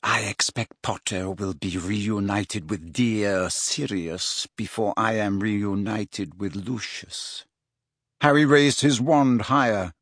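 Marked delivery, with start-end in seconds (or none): 0.0-7.4 low, slow, soft icy menace; deliberate, cool threat; Narcissa Malfoy. A narrator's reading. Mother taunting Potter about his dead godfather, icy.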